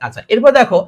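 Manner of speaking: usual speaking voice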